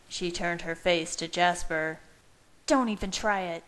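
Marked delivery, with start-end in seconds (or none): 2.6-3.6 mild snarling warning. A narrator's reading. Leah warns Jasper off calming her, mildly snarling. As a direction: warn them off with a touch of snarl. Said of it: "she snarled".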